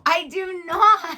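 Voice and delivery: loud, high, drawn-out protest; mock indignant denial. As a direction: deny it slowly with mock outrage. Mock quarrel between hosts.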